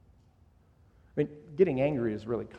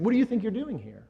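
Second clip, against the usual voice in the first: higher, a touch of scolding challenge; mock rebuke; 6 semitones higher, faster than usual. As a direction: play the stern rebuke he does not mean. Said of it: "God isn't rebuking Elijah".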